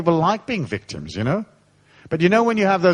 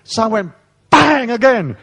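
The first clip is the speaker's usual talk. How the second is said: louder, higher and slower, relishing the hit; hammed-up punch story; rising glee at the whack; excited bang; 7 semitones higher, 5 dB louder than usual. Retelling hitting him.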